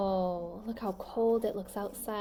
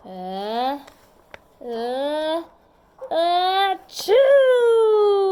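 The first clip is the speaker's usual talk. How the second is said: ah, ah, ah rising higher and louder to a loud choo; building sneeze; 6 semitones higher, 10 dB louder, much slower than usual. Acted. The sleeping bear sneezing from a pepper flake, building to a loud blast.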